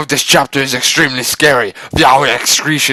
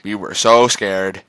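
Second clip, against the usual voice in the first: plain reading voice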